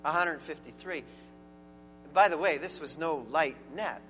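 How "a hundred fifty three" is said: scripture reading. Acted. Pastor reading the Gospel to the congregation, solemn reading. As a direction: read the passage aloud with reverence.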